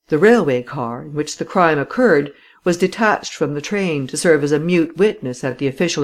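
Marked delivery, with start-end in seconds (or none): none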